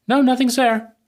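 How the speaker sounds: a mock parent waving the child away; dismissive denial. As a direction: brush it off in a sing-song mock. Repeats the cult's denial.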